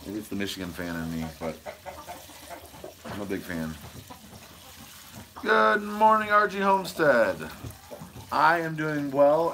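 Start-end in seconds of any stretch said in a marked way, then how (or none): 5.4-9.5 higher and louder, a bright hello to a viewer; cheery loud greeting. Real, not acted. Vlogger greeting a live chat viewer, cheery loud hello. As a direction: call out good morning with a big lift.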